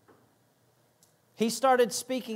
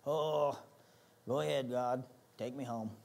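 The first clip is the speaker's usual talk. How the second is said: lower, softer, mopey giving-up voice; imitating a discouraged believer, lower and softer in mock despair; mock self-pity; 5 semitones lower, 6 dB quieter than usual. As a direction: play the defeated believer, half mocking. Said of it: "how you start feeling".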